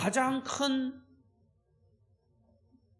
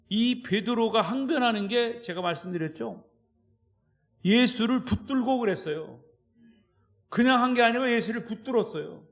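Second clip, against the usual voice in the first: ordinary delivery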